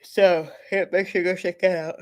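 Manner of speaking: talking through a yawn; words stretched by a yawn. Real, not acted. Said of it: "I'm yawning so much today".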